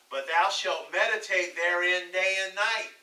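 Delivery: preacher reading out scripture. Acted. Reads scripture aloud.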